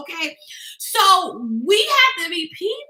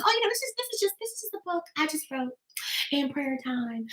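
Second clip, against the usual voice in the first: much softer, self-deprecating mumble; meek mumbling humility; 12 dB quieter than usual. Pretend. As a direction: shrink away from your own book. Mocking meek author pitching book.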